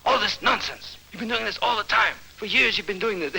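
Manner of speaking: high, exasperated complaint; acted scolding. Fed-up complaint about years of nonsense, scolding.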